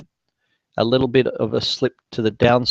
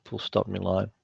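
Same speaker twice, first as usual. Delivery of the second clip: quieter dictation command aside; dictating; 3 semitones lower, 10 dB quieter than usual. Real, not acted. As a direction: drop the voice to dictate punctuation. Dictating punctuation to software.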